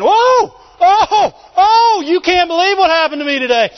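high, loud whoops of amazement; whooping, over-the-moon exclamation; victorious shout. Acted. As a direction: burst out shouting with exciting news. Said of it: "they're shouters".

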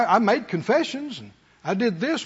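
ordinary talk

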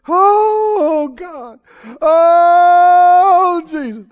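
high, loud sobbing cries of Oh with a broken drop in between; mock wailing prayer. Preacher mocking how people wail at God in prayer, mock sobbing wail. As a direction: wail it like a pitiful crying prayer, hammed up. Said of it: "listen to the tone".

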